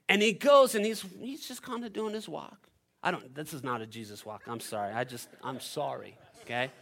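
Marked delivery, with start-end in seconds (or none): none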